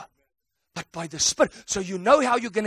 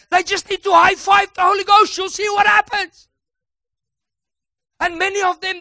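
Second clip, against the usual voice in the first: full-force preaching; 8 semitones higher, 6 dB louder than usual. Acted. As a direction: rouse the whole church.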